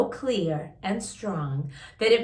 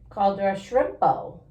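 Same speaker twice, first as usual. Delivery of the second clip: plain reading voice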